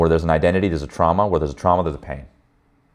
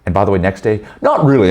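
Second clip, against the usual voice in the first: normal talking voice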